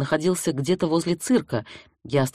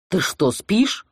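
indignant; 4 semitones higher, 3 dB louder, much slower than usual. A narrator's reading. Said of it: "возмущённо спросил Волков".

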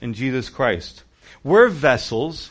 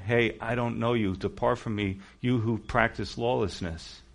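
solemn Jesus; 4 semitones lower, 7 dB quieter than usual. Acted. Jesus shutting out the foolish virgins, low and grave. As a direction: hush it down to a grave dismissal.

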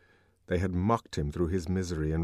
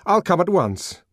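urgent reply; 10 semitones higher, 6 dB louder than usual. Acted.

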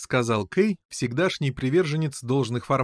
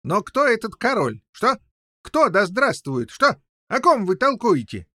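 testy, high, louder questioning; irritated; 9 semitones higher, 5 dB louder than usual. A narrator's reading. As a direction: fire off exasperated questions. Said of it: "раздражительно воскликнул король Пеленор". (раздражённо восклицая)

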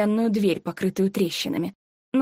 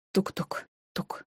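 unvoiced tuk-tuk fading; whispered knocking; 8 dB quieter, slower than usual. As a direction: breathe the knocks. Knocking sound in her head. (шёпотом стук)

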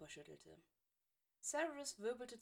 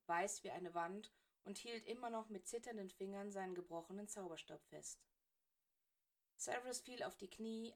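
ordinary delivery

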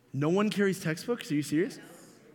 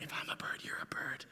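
breathy romantic whisper; 13 dB quieter than usual. Retold or imitated. Young boy whispering a love line down the telephone game. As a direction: breathe it like a shy little Romeo. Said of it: "I whisper it".

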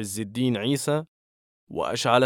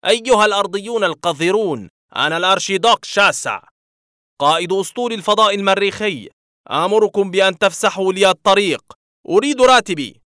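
high, loud, rising into a shrieking alien voice; an overwrought Martian archduke screaming orders; pompous alien tantrum, louder and higher to the last word; nervous scream; 8 semitones higher, 7 dB louder than usual. A narrator's reading. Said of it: "صرخ رجل أخضر في عصبية".

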